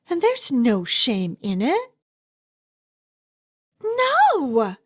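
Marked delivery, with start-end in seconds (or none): 3.8-4.8 high, quick cry of denial; sharp protest. Acted. Speaker rushing to deny the listener is disgusting, high sharp protest. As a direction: cut off their shame at once.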